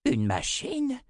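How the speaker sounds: high, astonished question. A narrator's reading. Zed hearing of a strange machine, astonished question. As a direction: leap up in disbelief on machine. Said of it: "s'étonna Zed".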